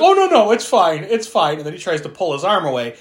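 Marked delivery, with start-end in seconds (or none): 0.0-1.6 high, loud mock reassurance; mock calm. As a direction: brush it off in a high voice. Retold character brushing off bite.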